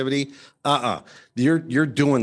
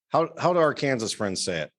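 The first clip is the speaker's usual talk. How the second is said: ordinary talk, nothing marked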